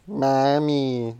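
drawn-out teasing copy of his sister; mocking whine. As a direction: tease her by stretching the word. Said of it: "pick on her sometimes".

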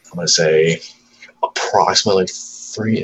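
ordinary talk

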